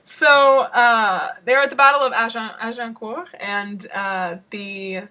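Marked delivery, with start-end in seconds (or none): 0.0-3.3 French accent. Shown as a demonstration. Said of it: "instructed to say in a French accent".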